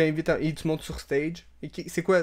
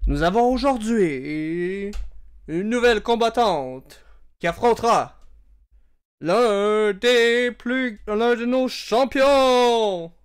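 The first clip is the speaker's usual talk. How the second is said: slow, drawn-out, louder and higher announcer call; ring announcer; 6 semitones higher, 3 dB louder, much slower than usual. Acted. GM as announcer introducing the champion, booming. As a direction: stretch every word like a boxing MC.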